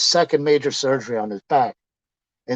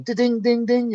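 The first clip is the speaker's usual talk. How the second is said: high-pitched ringing dings; imitated coin clinks; 9 semitones higher, slower than usual. Handful of yen hitting the window, high dinging. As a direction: mimic coins pinging off glass. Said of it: "you hear these things going".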